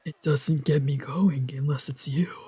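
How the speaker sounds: hushed intimate whisper. Acted. Fighter whispering to his rival, seductive whisper. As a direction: breathe it close, barely voiced.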